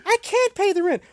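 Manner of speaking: high, pleading damsel voice; damsel in distress. Acted. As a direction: wail like a helpless silent-film heroine.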